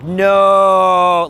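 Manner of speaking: long drawn-out loud "no"; mock crowd disapproval; jeering no. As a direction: stretch out a loud, heckling no. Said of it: "the guy's like".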